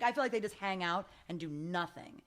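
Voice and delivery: ordinary talk, nothing marked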